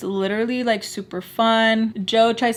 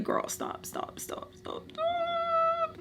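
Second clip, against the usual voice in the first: whispered, repeated plea to stop; whispered self-scolding; 13 dB quieter, much slower than usual. Retold or imitated. Telling herself to stop mimicking, urgent whisper. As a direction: hiss it to yourself under your breath.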